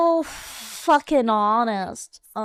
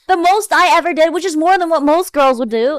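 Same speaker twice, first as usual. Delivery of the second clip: exasperated; 7 dB louder than usual. Real, not acted. Exasperated, loud.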